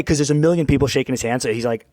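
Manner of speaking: ordinary talk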